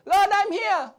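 high, loud answering call; Adam answering God. Acted. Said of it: "what did Adam say?".

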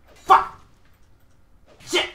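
loud curses; frustrated yell. Real, not acted. Gamer curses at the game, loud angry yell. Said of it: "Sorry that I'm yelling".

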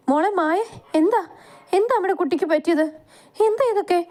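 mother sees injured daughter and wails; crying. Acted. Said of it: "അതും പറഞ്ഞ് ലക്ഷ്മി കരഞ്ഞു". (കരഞ്ഞുകൊണ്ട്)

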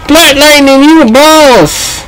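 high, stretched-out cheer; elated praise. Real, not acted. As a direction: cheer it high, dragging the last word. Cheering the game character.

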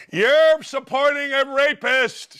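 high, slow mock protest; mocking whine. Host parrots his critics before dismissing them, mocking.

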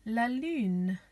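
"la lune" said in French with ü; French pronunciation. Shown as a demonstration. Teacher to children learning the moon in French, "la lune" pronounced with ü. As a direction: model "la lune", drawing out the ü. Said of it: "which is called".

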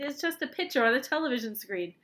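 ordinary delivery

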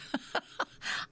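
breathy chuckle. Real, not acted. Laughs at choosing Queen of Hearts.

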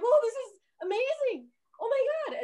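squealed high with delight; mock delight. Officials thrilled at finding a director, squealing high. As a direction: shriek out your amazement, way up high.

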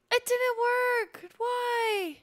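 high, slow, loud wail of dismay; dismayed outcry. Real, not acted. To her chat when the alert fails, a high loud dismayed wail.

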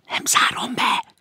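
slow, defeated whisper; broken whisper. A narrator's reading. Maud gives in to the stranger, whispering brokenly. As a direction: whisper it in surrender. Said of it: "suttogta megtörten a nő".

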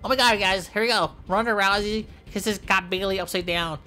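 normal talking voice

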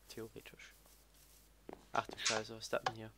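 unvoiced whispered aside; whispering. Real, not acted. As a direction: whisper it to yourself. (flüsternd)

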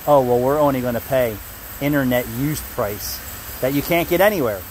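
ordinary talk

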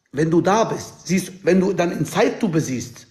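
ordinary talk, nothing marked

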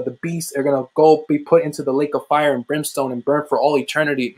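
ordinary delivery

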